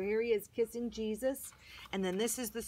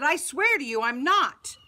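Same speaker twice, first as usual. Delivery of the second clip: shouting; 5 semitones higher, 9 dB louder than usual. Acted. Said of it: "I shouted".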